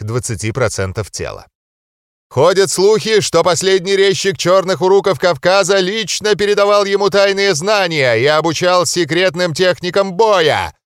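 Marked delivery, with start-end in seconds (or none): 2.3-10.7 high, loud straining ring announcer; надрывающийся рефери. A narrator's reading. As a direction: strain to be heard over the hall. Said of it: "надрывался рефери". (рефери надрывается, объявляя бойца)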